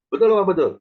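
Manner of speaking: loud call to the crowd. Real, not acted. Preacher demanding an answer from the congregation, loudly. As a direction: rouse the congregation with a shout.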